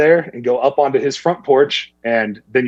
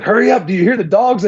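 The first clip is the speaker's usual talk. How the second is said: imitating a pushy call, much higher; urgent partner; 9 semitones higher than usual. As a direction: rush him along. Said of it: "And he's like".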